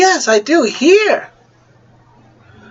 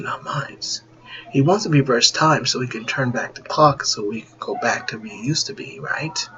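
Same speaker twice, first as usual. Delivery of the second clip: hushed whisper; 5 semitones lower, 7 dB quieter than usual. Acted. Universe's hushed reply.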